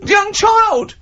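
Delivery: high, shrill-pitched panicked bystander crying out; panicked onlooker. Pretend. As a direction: squeal it like a frightened passer-by. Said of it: "people walk along and they go".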